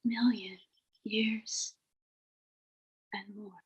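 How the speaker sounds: soft, fading meditation voice, almost a whisper; guided meditation. Acted. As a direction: let the words drift away into silence.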